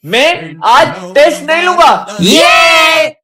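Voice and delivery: drawn-out loud cry; slow frightened shout. Acted. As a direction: shout slowly in shock. Ghost spooks the teacher.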